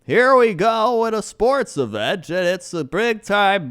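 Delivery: high, loud, slow announcer; mock monotone play-by-play; Joe Buck impression. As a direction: ham up a stock sportscaster. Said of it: "he can't change his vocal tone".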